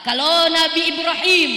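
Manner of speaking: ordinary talk, nothing marked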